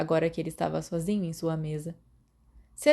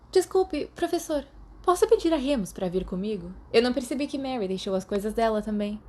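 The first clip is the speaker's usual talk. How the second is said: higher, eager schoolgirl voice; polite girl; 5 semitones higher, 3 dB louder than usual. A narrator's reading. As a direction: play a girl asking a favour politely. Lily voiced in read story.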